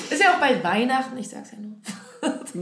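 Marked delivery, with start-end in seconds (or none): 1.2-2.6 breathy, teasing whisper; whispered aside. Real, not acted. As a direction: whisper it as a sly hint. Teasing aside about Christmas gifts.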